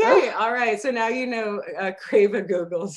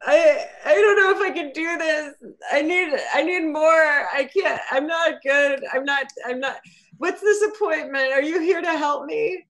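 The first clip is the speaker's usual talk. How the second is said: high-pitched acted distressed pleading; wailing, sobbing; 5 semitones higher than usual. Distraught patient opens her door to a stranger, mock wailing. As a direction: plead as if mid-sob. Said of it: "wailing, sobbing".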